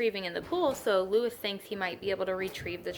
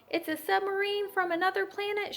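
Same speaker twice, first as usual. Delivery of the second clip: shrieking; 11 semitones higher than usual. A narrator's reading. Coach spotting the creature in the pool, mock shriek. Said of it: "shrieked the coach".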